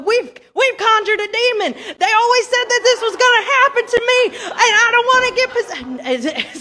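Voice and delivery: high, loud, laughing mock terror; hammed-up panic. Prankster fakes terror at the séance, hammed-up high panic. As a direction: ham up being possessed, half laughing. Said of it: "So just playing it up".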